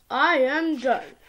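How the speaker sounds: plain reading voice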